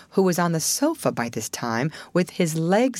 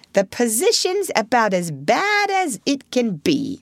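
grumpy; 7 semitones higher than usual. A narrator's reading. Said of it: "replied the Mole grumpily".

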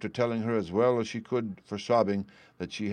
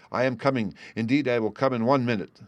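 plain reading voice

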